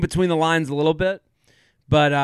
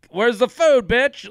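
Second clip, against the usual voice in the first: high, cocky mock demand; rude boyfriend; 7 semitones higher than usual. Host imitating the boyfriend arriving empty-handed, cocky mock demand. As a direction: play the boorish guest barging in. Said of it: "and be like".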